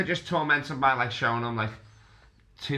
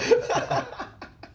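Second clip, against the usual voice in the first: high breathy laugh. Real, not acted. Laughing at team manager joke.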